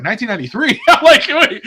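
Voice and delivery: high, loud talk breaking into laughter; incredulous and laughing; cracking up. Real, not acted. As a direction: laugh through the retelling. Cracking up at the absurd date.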